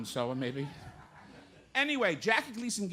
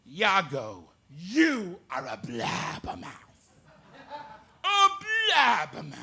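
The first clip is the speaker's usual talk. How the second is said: Gleason Shakespeare bit; 2 semitones lower than usual. Retold or imitated. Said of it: "Jackie Gleason doing Shakespeare".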